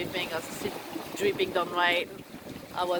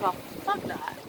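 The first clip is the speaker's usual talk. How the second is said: softer, whispered curse under breath; whispered giving up; 5 dB quieter than usual. Retold or imitated. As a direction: breathe it out resigned. Giving up on the paddle.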